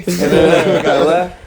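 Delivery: loud, slow punchline through laughter; laughing. Real, not acted. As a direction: crack up while finishing the story.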